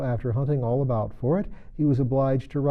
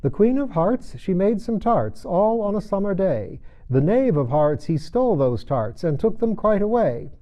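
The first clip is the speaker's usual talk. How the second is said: high, louder proclaiming of the verse; herald reading; 8 semitones higher, 5 dB louder than usual. A narrator's reading. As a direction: read it out like a court herald. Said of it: "read as follows".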